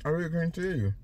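talking through a mouthful; words round a mouthful of peanut butter; mouth full of peanut butter. Real, not acted. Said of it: "my mouth full of peanut butter".

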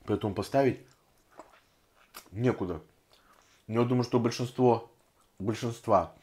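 eating between words; talking while chewing. Real, not acted. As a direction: mouth partly full. Seen 1: Puts food in mouth with fingers and chews throughout the words.

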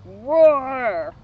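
loud growling roar; monster roar. A narrator's reading. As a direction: roar like a big beast. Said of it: "The shriek grew louder".

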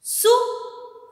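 "सुराही" drawn out slowly, syllable by syllable; slow syllable drill. Shown as a demonstration. Teacher to kids, slowly sounding out "सुराही". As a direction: stretch "सुराही" for the class to copy. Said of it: "उ की मात्रा ... जल्दी बोली जाती है".

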